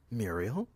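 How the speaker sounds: softly rising question on Muriel; questioning call. A narrator's reading. Someone behind Muriel asks for her, questioning. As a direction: Muriel said quietly with a sharp final rise. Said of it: "a voice asked behind her".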